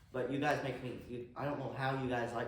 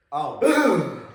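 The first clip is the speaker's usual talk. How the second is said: sudden startled cough; 8 semitones higher, 13 dB louder than usual. Real, not acted. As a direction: caught off guard, cough it out. Nearly sneezes, then coughs.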